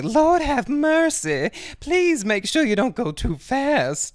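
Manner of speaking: very high, loud and slow pleading; fretful old lady. Retold or imitated. A nervous mother begging the driver to go slow, high and loud. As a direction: wail it high and slowly like a nervous grandma. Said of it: "she's like".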